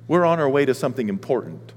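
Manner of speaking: usual speaking voice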